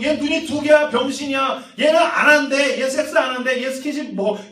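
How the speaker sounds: loud, high taunting; high jeering that drops at the end; finger-pointing jeers. Acted. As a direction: pile on insults like a mocking crowd. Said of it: "세상에서 너한테 어떤 손가락질하고".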